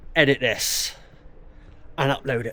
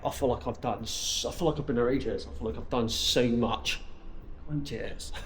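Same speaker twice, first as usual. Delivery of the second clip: emotional; 3 semitones lower, 8 dB quieter than usual. Real, not acted. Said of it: "it's making me emotional".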